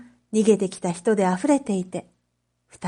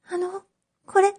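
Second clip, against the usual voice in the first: put-on shy young girl; 9 semitones higher than usual. Oshichi shyly returns tweezers.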